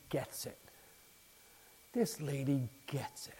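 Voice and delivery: hushed, awed whisper. Real, not acted. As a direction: breathe it in wonder. Awed aside.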